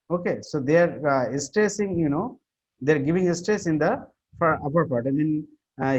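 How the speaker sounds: normal talking voice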